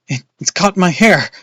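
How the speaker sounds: high, loud stammered cry; frightened cry. A narrator's reading. Boy cries as a bat tangles his hair. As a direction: cry out as it grabs your hair. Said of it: "I cried".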